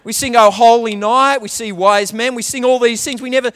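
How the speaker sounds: ordinary talk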